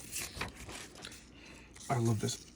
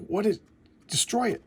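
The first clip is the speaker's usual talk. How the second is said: higher, urgent command; barked order; 8 semitones higher than usual. Retold or imitated. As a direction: shout the order to fire.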